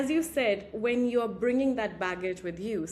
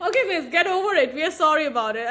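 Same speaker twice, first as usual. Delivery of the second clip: fast, laughing, higher mock schoolboy shrugging it off; quoting the boys; 5 semitones higher, 6 dB louder, faster than usual. Boys brushing off the teacher, fast and laughing. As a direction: rattle it off like a bored boy, laughing. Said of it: "so much difference".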